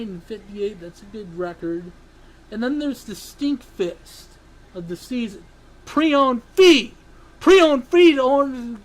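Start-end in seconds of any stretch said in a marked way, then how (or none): 5.8-8.8 loud, higher, ranting yell. Real, not acted. Host ranting about the stink fest of the season, yelling. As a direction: shout it out in a rant. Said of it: "I'm gonna yell one more time".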